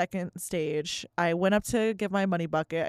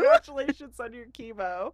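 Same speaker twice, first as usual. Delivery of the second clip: laughing mock congratulations; 3 semitones higher than usual. Real, not acted. Laughing while quoting gaffe.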